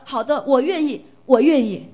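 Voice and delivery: loud, eager yes from the family; the whole family eagerly saying yes; eager agreement. Retold or imitated. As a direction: answer gladly and loudly, all together. Family all agreeing to pray.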